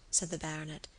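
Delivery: ordinary delivery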